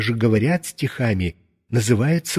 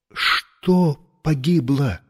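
ordinary delivery

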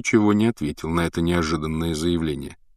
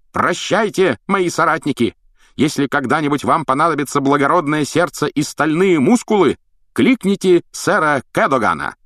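громкий крик; 9 semitones higher, 4 dB louder than usual. Acted. Рыцарь кричит, убегая в картину.

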